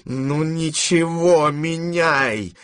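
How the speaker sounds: high, slow, firm order; resolute shout. A narrator's reading. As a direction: call out the decision firmly. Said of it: "крикнула она решительно". (решительный выкрик)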